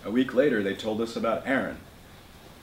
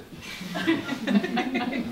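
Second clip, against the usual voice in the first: a short breathy scornful laugh; scoffing laugh; 13 semitones higher, 4 dB quieter than usual. Acted. Slava scoffing at grandfather's claim, breathy laugh. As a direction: laugh it off in disbelief.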